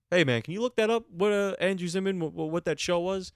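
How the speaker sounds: high, loud call to someone. Real, not acted. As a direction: shout across the room for help. Calling out to absent helper.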